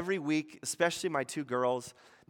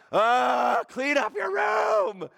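put-on high exasperated yell at the kids; mock parent yell; 8 semitones higher, 4 dB louder, much slower than usual. Pastor acting a frustrated parent yelling at his kids. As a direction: shout it like a fed-up parent.

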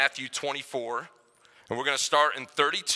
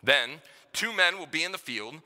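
softer, measured Bible reading; scripture reading; 5 dB quieter than usual. Acted. Pastor reads Matthew twenty-four aloud to the church, softly and solemnly.

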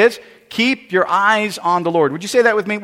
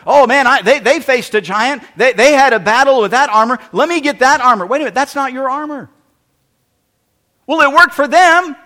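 louder, grabbing at someone else's armor; mock eager believer; eager borrower of faith; 4 semitones higher, 6 dB louder than usual.

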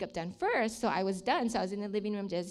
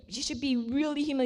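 ordinary talk

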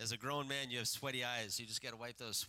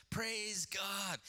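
a mild raised, higher shout of praise; 7 semitones higher than usual. Acted. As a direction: cry out thanks to God, lightly acted.

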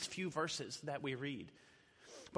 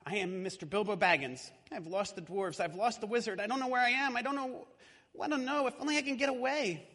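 higher and louder, rising panic; lost hobbit pleading in the dark, higher and louder; frightened hobbit; 6 semitones higher, 5 dB louder than usual. Acted. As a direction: blurt it out, lost and scared. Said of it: "I do the voices".

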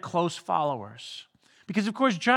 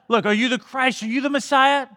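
higher-pitched, mildly insistent questioning; pressing questioner; 5 semitones higher, 3 dB louder than usual. Acted. Leaders asking John if he is the Messiah, pressing. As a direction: press for an answer, voice lifted.

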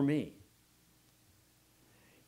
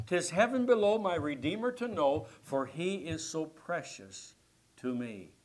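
loud hymn verse, preached; reciting a hymn; 4 semitones higher, 6 dB louder than usual. Acted. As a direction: declaim each hymn line with fervor. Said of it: "someone penned these words".

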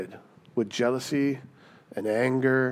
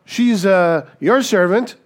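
higher, louder, hurried dodge of a husband escaping; breezy brush-off; 6 semitones higher, 6 dB louder than usual. Retold or imitated. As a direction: toss it off and head for the door. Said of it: "And he says".

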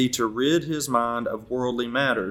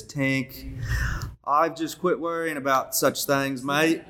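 lightly imitated Australian accent, pitched higher; 4 semitones higher, slower than usual. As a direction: do Katie's Aussie accent with a drawn-out mate. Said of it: "in her Australian accent".

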